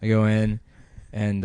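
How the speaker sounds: ordinary delivery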